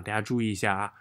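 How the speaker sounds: ordinary talk, nothing marked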